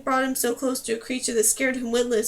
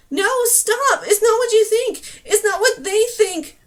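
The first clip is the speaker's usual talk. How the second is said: loud, much higher pleading cries; waving her arms, shouting at the griffins; desperate shouting; 9 semitones higher, 8 dB louder than usual. A narrator's reading. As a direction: scream it into the wind. Running at creatures, shouting.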